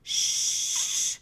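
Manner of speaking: breathy shh; a firm little shush. Acted. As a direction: hush the animals. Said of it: "George shushed them".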